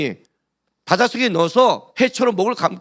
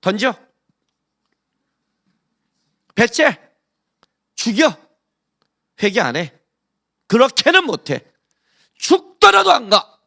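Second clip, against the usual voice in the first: stubborn defiance; 2 semitones higher than usual. Retold or imitated. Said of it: "네, 이렇게 말하는 거예요".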